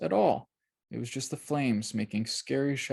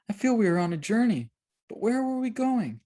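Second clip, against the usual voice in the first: put-on confused girl voice; 11 semitones higher than usual. A narrator's reading. Christiana confused.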